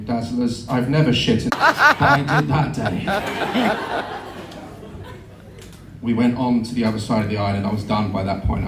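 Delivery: ordinary delivery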